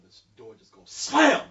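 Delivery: high, slow, drawn-out slam; mock sound effect. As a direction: act out the door banging open. Door slamming in the club.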